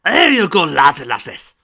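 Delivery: big, loud Scottish call; Scottish brogue. A narrator's reading. Grampus serving the group drinks, hearty Scottish brogue. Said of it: "his Scottish brogue still present".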